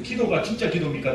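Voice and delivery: ordinary delivery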